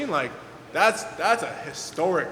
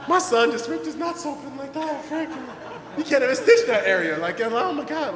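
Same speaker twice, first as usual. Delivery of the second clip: a mom panicking over her injured son, hammed up; frantic mom; 8 semitones higher than usual. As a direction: imitate a mom losing it. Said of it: "having a spaz attack in the corner".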